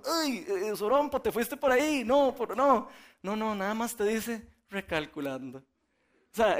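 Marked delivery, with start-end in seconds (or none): 0.0-2.0 higher, louder nagging tone; preacher imagines a scolding GPS, higher and louder; mock scolding. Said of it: "no lo regaña uno". 4.7-5.6 calm GPS voice. Retold or imitated. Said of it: "es esa vocecita".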